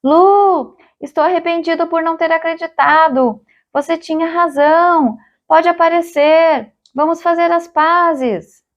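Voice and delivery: high-pitched pleading call; calling out high to make peace; shouting. A narrator's reading. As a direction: shout an apology to someone hidden. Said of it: "Gritou de novo".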